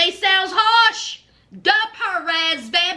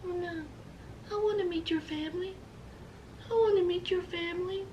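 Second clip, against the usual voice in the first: tiny, barely audible murmur; imitating Amanda; 14 dB quieter, faster than usual. Amanda answering about meeting his family, mimicked very quietly. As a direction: mimic her in a very soft, hushed little voice. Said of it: "So here's Amanda".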